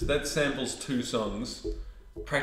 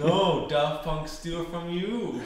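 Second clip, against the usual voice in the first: playful fake Russian accent, slower and higher; mock Russian accent; 6 semitones higher, slower than usual. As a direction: lay on a jokey Russian accent like a Soviet Russia gag. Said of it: "that was a great Russian accent".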